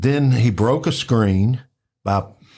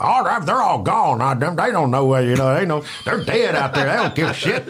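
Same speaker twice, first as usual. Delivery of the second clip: higher, careless Joe voice; mock Joe brushing it off; 5 semitones higher than usual. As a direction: shrug it off like a tough old biker. Joe quoted dismissively.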